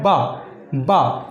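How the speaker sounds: बा said with both lips pressed together; a clear b in बा; बा with the lips closed, then released; demo of ba. Shown as a demonstration. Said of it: "दोनों होंठों को ऐसे चपकाना है और फिर बोलना है".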